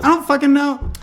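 high, loud frustrated answer; exasperated. Retold or imitated. Answering girlfriend with high frustrated cry. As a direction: throw up your hands vocally. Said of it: "I'm like".

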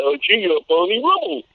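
dopey cartoon sidekick talking to Fred; Barney Rubble voice. Shown as a demonstration. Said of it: "Foghorn Leghorn goes into".

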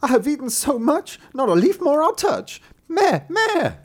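very high, loud goat voice; bleating goat. Acted. Goat answers the youth at night, high bleating voice. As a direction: bleat the rhyme shrilly like a goat.

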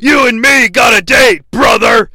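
loud gruff shouted wrestler promo; Hulk Hogan impression. Recapping a wrestling promo, shouting as Hulk Hogan. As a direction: bellow it like Hogan cutting a promo. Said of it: "Hulk Hogan saying".

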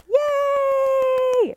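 high, loud happy yay; excited cheer. Real, not acted. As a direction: cheer like celebrating a win.

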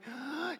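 sharp, high imitated gasp of shock; mock gasp. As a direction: gasp like a startled little girl. Daughters rush to the car.

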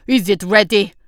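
loud shouted question; shouting back. Acted. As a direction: call it back over the noise. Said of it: "Unathi shouted back".

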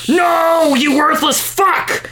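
high, drawn-out mock scream; hammed-up read-aloud scream.

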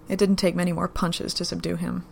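ordinary delivery

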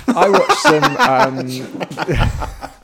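laughing through the words; giggling while speaking. Real, not acted. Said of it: "still eating".

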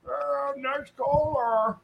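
slow, heavy masked villain voice; drawn-out muffled Bane impression. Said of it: "So it actually does sound like Bane".

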